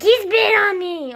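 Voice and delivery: high, loud drawn-out wail; banshee scream. Retold or imitated. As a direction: imitate your own sobbing banshee shriek. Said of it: "screaming like a banshee and sobbing".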